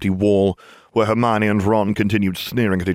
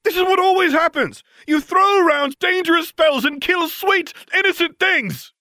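furious rant. Acted. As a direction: hurl the blame at her, dragging the words. Harry ranting at Hermione.

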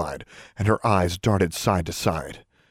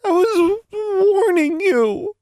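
put-on very high, slow, stammering girl's protest; frightened stammer; 4 dB louder, slower than usual. A narrator's reading. Tomo defends herself to Alexandra, stammering. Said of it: "Tomo stammered".

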